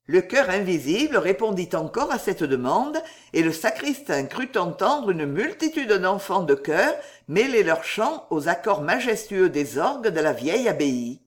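usual speaking voice

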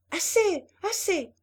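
high, loud call to stop. A narrator's reading. Hélène still calling down to Rambaud, high loud cry.

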